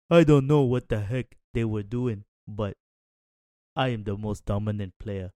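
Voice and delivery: half-hearted attempt at a star player's voice; Joel Embiid. Panelist quoting the sick player's boast, terrible impression. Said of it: "That was a terrible Joel Embiid impression".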